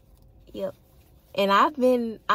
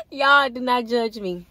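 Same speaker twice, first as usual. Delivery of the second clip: flustered plea; 6 semitones higher, 7 dB louder than usual. Real, not acted. Embarrassed plea to viewers.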